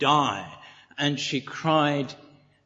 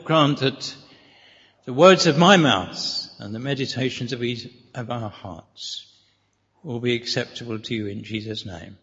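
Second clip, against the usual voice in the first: prayer; 6 semitones lower than usual. Acted. Preacher opening prayer to the Father, prayerful. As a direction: pray it, letting the voice sink at amen.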